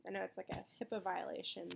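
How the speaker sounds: ordinary delivery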